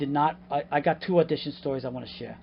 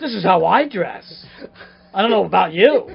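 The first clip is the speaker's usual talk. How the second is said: defiant retort; 5 semitones higher, 11 dB louder than usual. Retold or imitated. Gangster-dressed actor defending his outfit, loud defiance. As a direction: stand your ground, hammed-up indignation.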